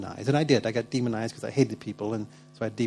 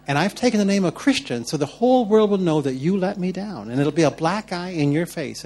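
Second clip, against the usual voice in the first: raised, high-pitched mock warning to God; accusing; 5 semitones higher, 4 dB louder than usual. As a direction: press the blame home as a hammed-up threat. Retold bargaining, blaming God.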